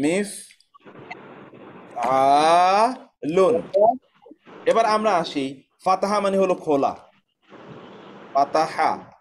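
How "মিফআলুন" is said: মিফআলুন stretched very long, rising at the end; drawn out demo. Shown as a demonstration. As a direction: draw out মিফআলুন like a model for learners. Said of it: "যন্ত্রবাচককে বোঝানোর জন্য হলো".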